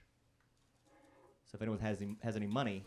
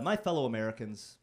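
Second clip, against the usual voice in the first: louder, grand speech voice; presidential speech opener; 5 dB louder than usual. Pretend. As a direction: open like a president addressing the nation. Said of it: "a fun way for a speech to start".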